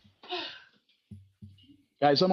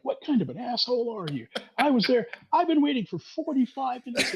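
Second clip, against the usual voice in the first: retold angry outburst; 10 semitones higher than usual. Retold rant at parking driver.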